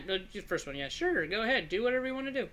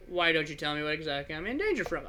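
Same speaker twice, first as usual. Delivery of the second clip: normal talking voice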